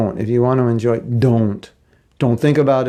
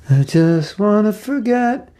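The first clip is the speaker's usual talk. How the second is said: high, slow, drawn-out pleading; hammed-up longing to escape; mock plea; 8 semitones higher, 3 dB louder, slower than usual. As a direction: stretch it as a put-on wail. Acts person craving oblivion.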